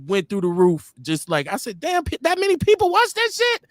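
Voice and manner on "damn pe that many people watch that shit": high-pitched mock disbelief; retold amazement. Dad retelling his shock at son's reel views, amazed. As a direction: blurt it out high, incredulous.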